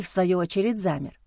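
ordinary delivery

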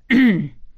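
polite throat clearing; a short cough to remind them; discreet cough. A narrator's reading. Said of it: "Дядя тихонько кашлянул".

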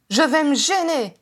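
high, loud retort; angry shout. A narrator's reading. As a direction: shout it back defiantly. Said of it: "cria Harry en se levant".

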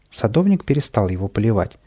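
ordinary delivery

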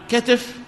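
كتف as "katif"; short a then short i in كتف; first dialect form. Shown as a demonstration. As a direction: say كتف slowly as ka-tif. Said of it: "ثلاث لغات في كتف".

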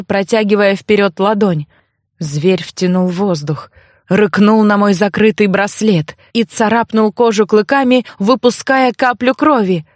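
ordinary delivery, nothing marked

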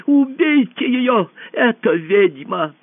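high, loud, stretched-out cry; shouted order. A narrator's reading. Usurper urging bodyguards against the empress, shouting. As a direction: cry out the accusation high and slow. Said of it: "крикнул он телохранителям".

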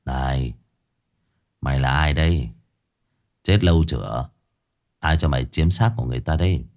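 low, cold, stern questioning; low menacing demand; cold interrogation. Acted. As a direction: stare down the spirit and question it coldly. Exorcist interrogates the possessed woman.

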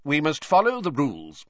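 a hint of a curt official; clipped rule-reading; lightly voiced curt official; Crouch, curt. A narrator's reading. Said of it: "his usual curt voice".

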